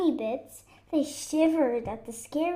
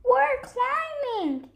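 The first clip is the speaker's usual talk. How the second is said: high, drawn-out playful holler; excited shout; 9 semitones higher, 3 dB louder, slower than usual. Acted. Animals call to Fox while climbing, excited shout. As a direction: sing out in a high, stretched shout. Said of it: "they shouted".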